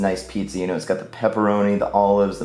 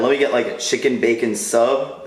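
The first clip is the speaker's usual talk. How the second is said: normal talking voice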